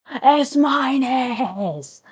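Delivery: slow, high, loud S minus!; excited cheer. Real, not acted. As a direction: stretch out S minus! in a triumphant yell. Cheering a top grade.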